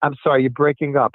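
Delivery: usual speaking voice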